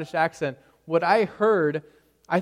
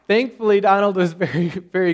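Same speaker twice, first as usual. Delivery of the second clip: loud, laughing aside; 4 semitones higher, 10 dB louder than usual. Real, not acted. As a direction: still laughing as you go on.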